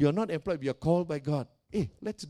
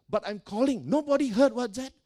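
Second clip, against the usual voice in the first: ordinary talk, nothing marked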